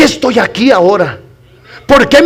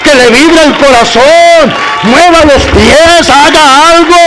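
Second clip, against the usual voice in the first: loud, higher preacher's exhortation; fiery preaching; 5 semitones higher, 4 dB louder than usual. Acted. Pastor urging the congregation to clap and praise, fiery preaching.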